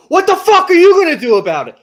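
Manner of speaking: high, very loud furious shout; mafioso rage. Retold or imitated. Confronting the manager, a full mafioso-style yell. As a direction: explode like a mobster losing it. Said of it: "mafiosa fucking lost my mind".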